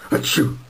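sharp sneeze. Acted. Engine catching a cold.

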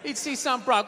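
ordinary talk, nothing marked

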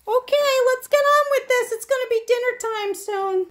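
high-pitched talking-dog voice; voiced impatient dog. Dog urging the owner to get on with this, impatient high voice. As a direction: put on the dog's impatient little voice.

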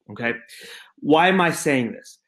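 usual speaking voice

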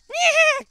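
very high, loud squawk; screamed boss cry. Retold or imitated. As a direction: shriek like an enraged game boss. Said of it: "he's screaming".

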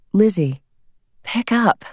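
high, pleading call into the machine; begging her daughter to answer; plaintive. Acted. Mother pleading on the answering machine, plaintive. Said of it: "The woman's voice on the machine was plaintive".

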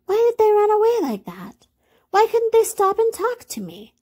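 very high toy-rabbit voice; high-pitched storybook lament; forlorn little rabbit. A narrator's reading. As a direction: wonder plaintively in a small high voice. Lonely rabbit wonders why they left.

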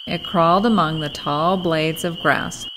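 ordinary delivery

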